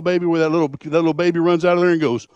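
usual speaking voice

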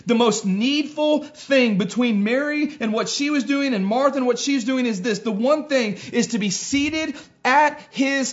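high, loud, insistent pulpit voice; fervent preacher driving the main point home; emphatic preaching. Real, not acted. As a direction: build to full force, then stretch out the key phrases. Preaching at full force.

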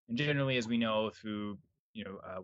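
normal talking voice

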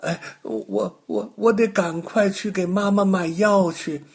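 put-on anxious small boy, rising and hurried; a little boy fretting about his mother's medicine; little boy's voice. Little boy fretting about buying medicine for his mother, anxious child voice.

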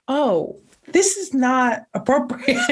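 prim objection. Retold or imitated. Voicing Brit's prim objection.

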